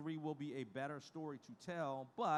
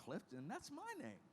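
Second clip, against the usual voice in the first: surprised; 11 semitones higher, 5 dB quieter than usual. Retold or imitated. Hearing his own name from her.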